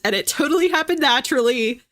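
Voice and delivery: normal talking voice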